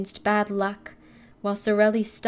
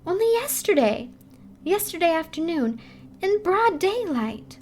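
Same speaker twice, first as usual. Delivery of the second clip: excited gossip; 9 semitones higher than usual. Acted. Excited girl telling gossip.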